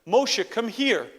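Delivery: loud, slow call to a son across the road; calling across the street. Retold or imitated. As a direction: shout it out like a father calling his boy across the street. Father calls son across street.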